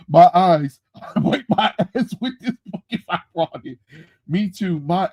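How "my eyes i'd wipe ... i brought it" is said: loud, high, laughing through the words; laughing rant. Real, not acted. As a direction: laugh while trashing the book. Mocking the comic, laughing.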